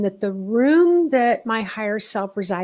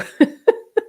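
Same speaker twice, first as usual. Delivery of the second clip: sudden squealing laugh; high burst of laughter; 12 semitones higher, 7 dB louder than usual. Real, not acted. Teacher laughing at her own joke to students. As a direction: laugh out loud at the idea.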